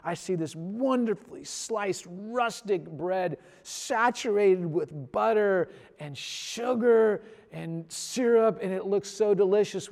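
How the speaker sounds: hammed-up foodie awe; rapturous relish. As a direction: draw out each ingredient with mouth-watering delight. Relishing description of the food.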